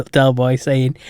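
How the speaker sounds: ordinary delivery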